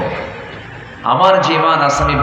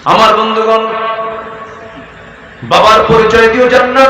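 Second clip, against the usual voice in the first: high, loud, chanted sermon cadence; full-force preaching; 7 semitones higher, 3 dB louder than usual. Acted. Preacher warning the gathering about paradise, full-force preaching. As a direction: preach it out over the crowd with force.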